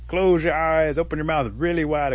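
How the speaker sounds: calling down. Acted. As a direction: call it down to a friend below. Possum calls instructions down.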